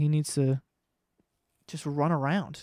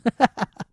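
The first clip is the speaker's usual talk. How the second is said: laugh; 7 semitones higher, 6 dB louder than usual. Real, not acted. Laughs at himself.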